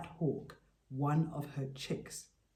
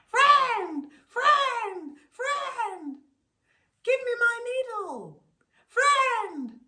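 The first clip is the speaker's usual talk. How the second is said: high, loud piercing hawk cry of a voice; keening bird voice calling its friend; 7 dB louder, much slower than usual. A narrator's reading. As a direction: screech it down from the sky like a hawk. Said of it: "called down".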